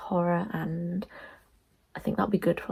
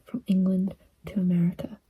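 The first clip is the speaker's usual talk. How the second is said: hushed whisper so nobody wakes. Real, not acted. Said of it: "I'm whispering 'cause people are in bed".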